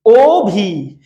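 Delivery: ওভি stretched into a long ও, then ভি; teacher slowly sounding ওভি as two beats; two-syllable demo. Shown as a demonstration. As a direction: draw out both syllables of ওভি. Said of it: "মুখের shape দুইবার change হচ্ছে".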